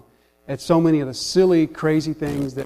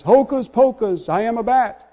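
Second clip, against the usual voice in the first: lightly voiced vampire spell, pitched high; Count Blood Count; 6 semitones higher than usual. Vampire in a duel of magic words, lightly mock-proclaiming. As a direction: proclaim it higher, as a mock incantation. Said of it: "the Count says".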